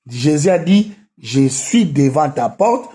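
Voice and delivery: ordinary talk, nothing marked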